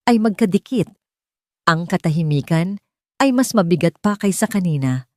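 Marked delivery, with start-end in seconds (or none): none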